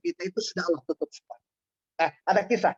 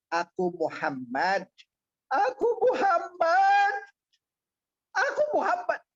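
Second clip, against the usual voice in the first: the Prophet revealing himself; 9 semitones higher, slower than usual. Acted. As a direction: let it swell into an emotional revelation. (suara Nabi yang makin tinggi dan haru)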